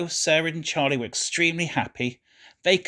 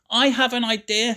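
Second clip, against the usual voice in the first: high, eager burst of an idea; sudden excited shout; 8 semitones higher than usual. A narrator's reading. Charlie calls out his idea to his parents, excited shout. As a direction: blurt it out with a boy's excitement. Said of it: "shouted Charlie suddenly".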